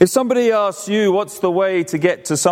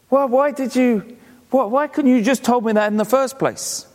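exasperated hiker; 6 semitones higher than usual. Retold or imitated. Hiker complaining to the silent farmer, exasperated. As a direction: let him sound put out and baffled. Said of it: "And the guy said".

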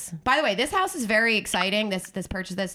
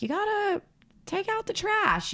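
put-on nagging girlfriend voice; 14 semitones higher than usual. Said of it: "if Carly's ever like".

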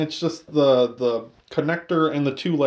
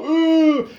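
high squealing ooh, much higher; mock pained ooh; 13 semitones higher, 5 dB louder than usual. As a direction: squeal it out like getting hit. Imitating Emil hit by car.

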